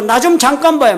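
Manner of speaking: a stranger calling out across the lot; calling out loudly. Retold or imitated. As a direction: hail a stranger from behind, a mild mock yell. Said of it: "막 소리를 지르며 부르는 거예요".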